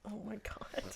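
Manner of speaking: whispering. Real, not acted. Reacts to creepy teacher joke.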